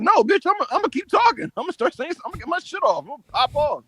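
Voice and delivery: high-pitched defiant mimicry; mouthy defiant Stratton. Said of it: "And she said".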